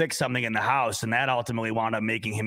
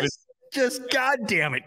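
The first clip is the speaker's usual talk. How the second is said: high, slow re-enacted curse; exasperated cursing; 10 semitones higher, slower than usual. Host cursing his buddy Kevin, exasperated. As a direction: drag it out, fed up.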